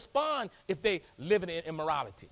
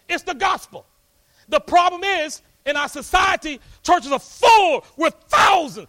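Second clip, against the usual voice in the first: full-force preaching; 8 semitones higher, 11 dB louder than usual. Real, not acted. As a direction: preach it out to the whole church.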